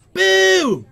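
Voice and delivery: big loud celebratory boom; shouting. Real, not acted. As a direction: belt it out like a hype yell.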